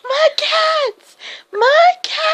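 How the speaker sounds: very high screeched wail; screaming old lady. Acted. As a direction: screech in anguish as the crazy cat lady. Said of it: "she starts screaming".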